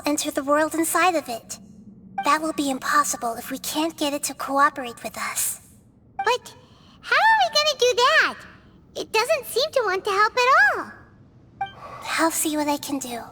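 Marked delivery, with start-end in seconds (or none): 6.2-10.9 high, worried question; anxious. Acted. Companion fretting over the dragon, high anxious voice.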